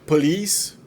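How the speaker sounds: ordinary talk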